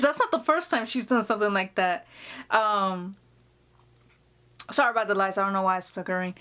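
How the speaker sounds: normal talking voice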